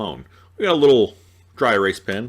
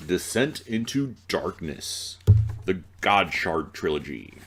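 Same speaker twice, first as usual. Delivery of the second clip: dramatic title; 4 semitones lower than usual. Acted.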